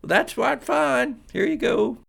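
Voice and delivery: high, drawn-out southern drawl; deep southern accent. Acted. Waitress hands over coffee to go, deep southern accent. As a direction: put on a high-pitched Southern belle. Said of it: "such deep southern accents".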